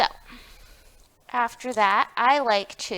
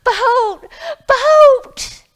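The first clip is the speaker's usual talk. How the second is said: put-on much higher and louder, the boy shouting across the water; calling out; 13 semitones higher, 13 dB louder than usual. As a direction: call the boat from far away, louder the second time. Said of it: "not until he called out".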